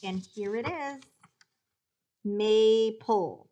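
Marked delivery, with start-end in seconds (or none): 2.2-3.5 "Maple" slowly as MAY-pull, long A; "Maple" drawn out and clearly divided; syllable by syllable. Shown as a demonstration. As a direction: stretch both syllables of "Maple". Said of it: "That first syllable is open with a long A".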